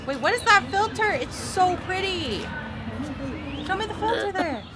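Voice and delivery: high, delighted squeal over a filter; excited. Real, not acted. Excited about a pretty filter.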